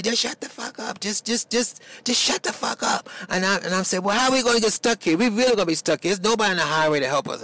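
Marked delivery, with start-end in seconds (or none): 0.0-3.1 high-pitched mock yell, no real volume; retold scream. Man in the stuck car yelling back at the driver, retold scream. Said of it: "he's screaming back at me". 4.0-7.4 high, rushed mock yell; retold panicked screaming. Driver stuck on the empty highway, retold frantic complaint. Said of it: "I'm screaming at Morris".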